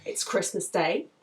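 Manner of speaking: ordinary delivery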